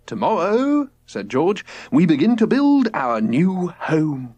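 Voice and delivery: a dreamy father preaching nature; lofty dad voice. A narrator's reading. George's voice.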